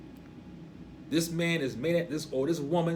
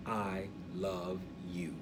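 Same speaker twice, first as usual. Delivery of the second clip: soft, low, slow words of love; hushed and unhurried; tender declaration; 7 semitones lower, 9 dB quieter, much slower than usual. Acted. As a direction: say it gently, letting each word sit. Explains Yahweh's words as love.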